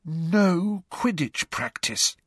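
slow, hollow, defeated; hollow tones. A narrator's reading. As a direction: say it slowly and emptily. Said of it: "said Angelina in hollow tones".

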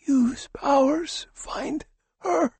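high, slow, breathless whisper; dying man whispering a desperate plea; faint whisper. Acted. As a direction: plead with the last breath you have.

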